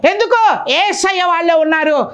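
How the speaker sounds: impassioned preacher; fervent preaching. Acted. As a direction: preach it out to the congregation. Preaching at full force.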